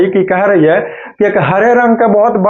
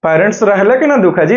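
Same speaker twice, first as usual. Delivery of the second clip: ordinary talk, nothing marked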